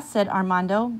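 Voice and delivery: plain reading voice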